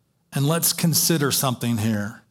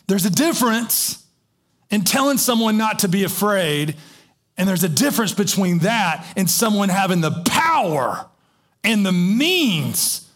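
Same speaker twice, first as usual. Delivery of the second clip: louder, rising preacher's emphasis; preaching at full force; 5 semitones higher, 3 dB louder than usual. Acted. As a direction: drive the point home from the pulpit. Preaching climax.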